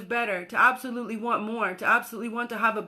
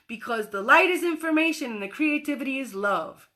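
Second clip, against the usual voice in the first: normal talking voice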